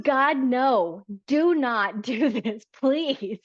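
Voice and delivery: high, loud, emphatic begging; urgent plea. Acted. The body warns a woman off a man, urgent pleading. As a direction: stretch each word into an exasperated plea.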